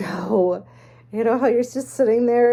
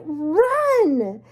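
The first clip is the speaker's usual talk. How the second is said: yelling; 8 semitones higher, 5 dB louder than usual. Retold or imitated. Yelling to flee.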